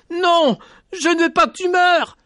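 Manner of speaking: high, loud panicked plea; panicked cry. Acted. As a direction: scream it out in panic. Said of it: "Il se mit à lui crier dans cette panique". (cri de panique aigu et fort)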